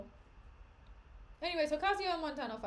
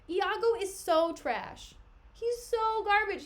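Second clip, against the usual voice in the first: exasperated; 7 semitones higher, 6 dB louder, slower than usual. Real, not acted. Reader condemns the villain.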